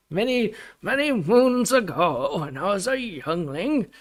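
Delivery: high, wavering, drawn-out storyteller voice; hammed-up, slow and singsong reminiscing; mock old storyteller. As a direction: intone it like a playful ancient tale. Mock-ancient storyteller opening, then drops it.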